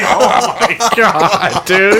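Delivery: high-pitched, laughing through the words; cracking up while quoting. Retold or imitated. Laughing retelling of friend's story.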